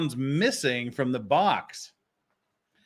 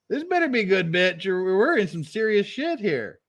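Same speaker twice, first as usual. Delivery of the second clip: higher, exasperated mock warning; 6 semitones higher than usual. Lawyer losing patience with his client, higher mock exasperation. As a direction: push it up in pitch, fed up. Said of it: "And he's like".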